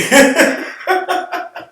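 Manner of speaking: loud laugh. Real, not acted. Teller laughs at own story.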